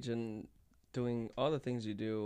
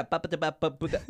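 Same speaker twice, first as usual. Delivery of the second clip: loud playful stutter sounds; mock stutter; 4 semitones higher, 4 dB louder, faster than usual. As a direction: stammer the syllables in fun. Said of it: "I stutter a lot".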